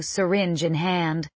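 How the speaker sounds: usual speaking voice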